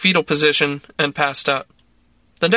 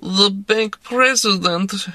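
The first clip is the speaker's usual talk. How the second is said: mournful; 5 semitones higher, slower than usual. A narrator's reading. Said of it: "she said mournfully".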